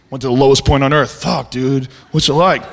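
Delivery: low mock bro admiring a dull trip; dopey bro voice. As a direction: play a dim, impressed bro. Mocking dull adventurers in low voice.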